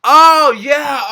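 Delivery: loud, high "oh yeah" as it clicks; blurting out the realization; sudden realization. Real, not acted. Suddenly reads the notes right.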